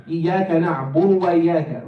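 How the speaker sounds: "نعبد" rushed, d flapped like "naburu"; rushed wrong dal. Shown as a demonstration. Teacher showing students what to avoid, hurried "نعبد" with flapped dal. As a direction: race through, slurring the d in "نعبد". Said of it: "We don't want to do that".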